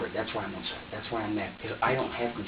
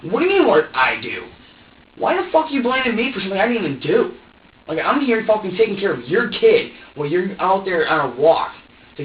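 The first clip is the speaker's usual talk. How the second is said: loud, higher-pitched angry outburst; indignant, raised-voice rant; heated argument; 4 semitones higher, 7 dB louder than usual. Retold or imitated. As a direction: hurl it back in a fed-up argument. Retold heated quarrel with partner.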